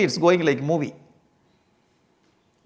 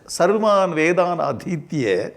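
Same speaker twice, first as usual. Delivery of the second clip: ordinary delivery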